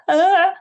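sudden high loud yelp; excited yelp. Retold or imitated. Singer reliving a studio moment, high loud yelp. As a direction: let out a quick thrilled shout. Trying a bold note in studio.

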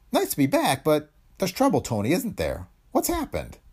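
high-pitched voice for a worried colleague; camera expert's voice. A narrator's reading. Cameraman asking Tony about trouble, high character voice.

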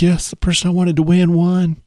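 retold excitement. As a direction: imitate her thrill, pitched well up. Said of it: "She's really excited".

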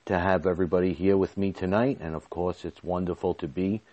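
ordinary talk, nothing marked

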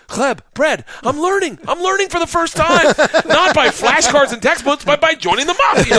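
very high, thrilled exclaiming; giddy excitement. Retold or imitated. Student suddenly understanding gangster's Russian, giddily excited. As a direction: marvel at yourself, pitch soaring.

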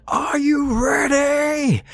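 very high, drawn-out stage scream; rock singer's scream. A narrator's reading. Singer at the mic to rock concert crowd, screamed.

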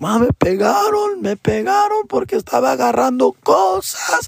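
put-on very high whimpering child voice; imitating a crying boy telling his mom; whiny kid. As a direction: complain to mom like a hurt kid. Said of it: "I'm like".

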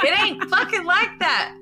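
high, loud indignant retort; defensive protest. Retold or imitated. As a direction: insist it's not like that. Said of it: "I'm like".

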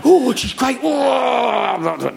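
high, drawn-out swoon; hammed-up ooh of approval; mock delight. Man seeing his screen lover, exaggerated delight.

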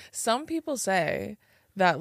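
normal talking voice

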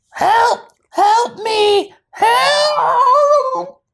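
high, loud panicked screams; screaming glass of water. Pretend. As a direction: shriek for help like a tiny victim.

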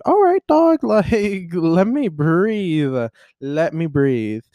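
high, loud opening sliding into drawn-out words; hammed-up begging for a break, stretching "breathe"; mock exasperated plea. Retelling pleas to an overwhelming week, mock exasperation. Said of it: "And I was like".

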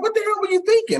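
high-pitched mock self-reproach; exasperated self-scolding. Reporter berating himself over a buried lead, exasperated. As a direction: replay scolding yourself, pitched well up. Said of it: "I'm so mad at myself".

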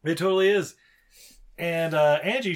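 ordinary talk, nothing marked

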